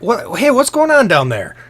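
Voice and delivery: loud, high-pitched challenge; alarmed calling out. Acted. Killraven to those below, loud alarmed call. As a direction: call down sharply to the commotion. Character calls down to the commotion.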